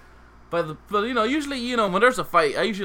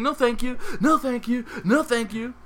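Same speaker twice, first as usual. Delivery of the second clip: higher, stubbornly polite no thank you; taking punches, still politely declining coke; repeated polite refusal; 7 semitones higher than usual. Retold or imitated. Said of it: "I kept saying".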